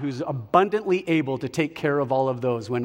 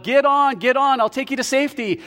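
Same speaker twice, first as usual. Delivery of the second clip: high, raised call from a pilot; pilot yelling; 6 semitones higher than usual. Acted. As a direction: yell down to a stranded man. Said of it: "the pilot yells out".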